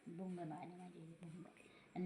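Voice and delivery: breathy whispered aside. Real, not acted. Grandmother whispers about the rabbit's antics. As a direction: whisper it under your breath.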